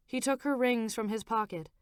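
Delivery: ordinary delivery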